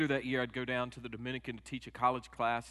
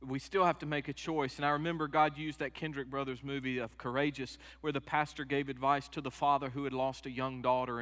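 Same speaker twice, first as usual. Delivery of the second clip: ordinary delivery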